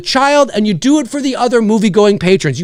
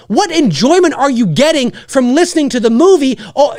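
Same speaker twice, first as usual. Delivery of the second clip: ordinary talk, nothing marked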